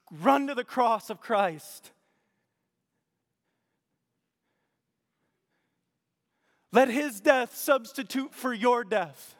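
loud, high, slow preaching call; preaching at full force. Real, not acted. Pastor urging the congregation to come to Christ, loud full-force preaching. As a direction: plead with the congregation to run to the cross.

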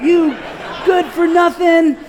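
road-rage shout at the other driver, angry and high. Retold or imitated. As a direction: yell it high and drawn out. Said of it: "words are being said".

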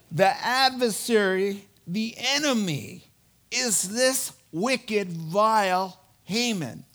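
accusing. Retold or imitated. Esther naming Haman to the king, slow accusation. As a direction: point the finger, word by word.

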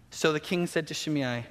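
usual speaking voice

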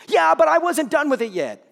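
put-on a kid defending himself; protesting child. Son voiced, protesting.

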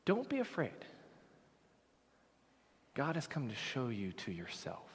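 quiet, tender comfort; soft reassurance. Acted. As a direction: gently let it sink in. Repeated after pause, softer.

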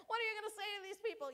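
high, fast anxious asking; anxious mom. Retold or imitated. Grandma fretting over granddaughter's manners, anxious. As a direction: rush the worried question. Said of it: "and I'm like".

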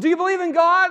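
high, loud, eager question; keyed-up rescuer; urgent questioning. Acted. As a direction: press him anxiously for an answer.

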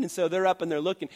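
normal talking voice

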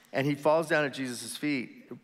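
usual speaking voice

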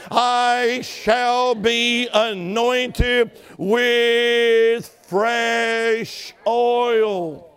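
very slow, drawn-out declaration; proclaiming slowly. Shown as a demonstration. Preacher modelling the declaration the church should shout, slowly. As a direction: stretch every word like a decree. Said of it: "You can begin to shout it out loud".

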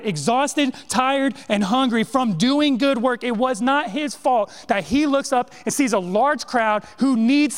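rising sermon fervour, pressing the congregation; full-force preaching. Real, not acted. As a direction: preach it hard to the church.

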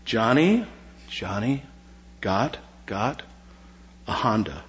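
lightly imitating a coach feeding words one at a time; mock prompt-and-echo, in short separate pieces with pauses; mock tongues coach. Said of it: "Say this after me".

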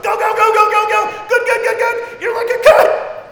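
very high, loud cheering; yelling encouragement. Retold or imitated. Teammate yelling for runners the whole time, loud cheering. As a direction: scream them on down the stretch. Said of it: "they're yelling for their teammates".

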